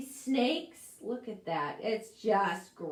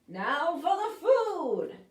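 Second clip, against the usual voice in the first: slow, loud, higher witch call; witchy announcement; 7 semitones higher, 6 dB louder, slower than usual. Acted. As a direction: announce it grandly.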